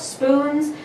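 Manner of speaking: ordinary talk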